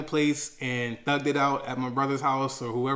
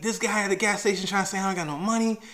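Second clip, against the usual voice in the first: higher, fast, upset complaint; yelling at the bank; 8 semitones higher, 4 dB louder than usual. Retold or imitated. Yelling at the bank about missing money, higher and fast. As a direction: fire questions at them in a panic. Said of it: "yelling at the bank".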